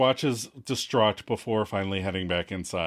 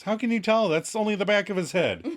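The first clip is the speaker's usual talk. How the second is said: high-pitched mock doubt; dubious; 7 semitones higher than usual. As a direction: question it sceptically in a higher voice. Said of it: "Monica's dubious".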